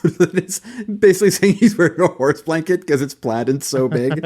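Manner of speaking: talking through real laughter, pitched high; laughing. Real, not acted. Host explaining the horse blanket joke to co-hosts, laughing.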